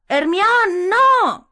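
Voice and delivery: very high, loud cry; Ron shouting. A narrator's reading. Ron shouting at Hermione through the gag. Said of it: "gritó Ron a través de la mordaza".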